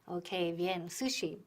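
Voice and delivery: normal talking voice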